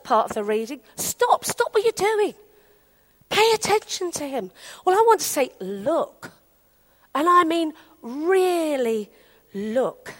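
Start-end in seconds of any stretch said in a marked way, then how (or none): 1.0-4.5 insistent appeal. Retold or imitated. Preacher relaying the call to pay attention, insistent. 5.6-6.3 low, soft, weighty "Look"; intent urging. Real, not acted. Preacher urging the congregation to "Look", low and intent. 8.0-10.1 weight "really", pause, drop on "look"; emphatic urging. Real, not acted. Preacher pressing listeners to "really look", quietly emphatic. Stresses looking properly.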